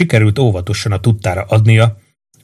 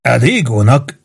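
ordinary delivery, nothing marked

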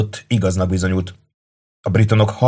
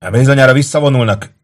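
raised, excited-soldier call; shouted; 5 semitones higher, 5 dB louder than usual. A narrator's reading. Soldier to comrades at the gate, calling out. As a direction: call out the news loudly. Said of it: "kiáltotta Kato egyik embere".

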